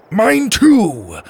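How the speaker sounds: high, loud cry of pain. Acted. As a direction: cry out loudly in agony. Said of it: "Grebe cried".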